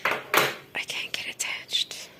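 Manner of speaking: whispered aside to herself; whispering. Real, not acted. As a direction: breathe it, half to yourself. Muttered aside while touring house.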